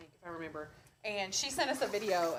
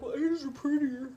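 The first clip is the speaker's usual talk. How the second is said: snooty judge voice; 3 semitones higher than usual. Retold or imitated. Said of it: "they're gonna be like".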